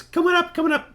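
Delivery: high-pitched cackling hermit; very high, eccentric little man; Prattis. Acted. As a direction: beckon them upstairs in a squeaky voice. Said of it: "sort of a cackling".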